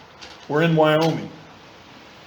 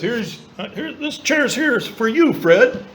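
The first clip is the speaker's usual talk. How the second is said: lightly voiced, higher, gentle God; a hint of a welcoming God; voicing God; 6 semitones higher than usual. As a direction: offer the seat kindly, a bit higher. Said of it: "God says".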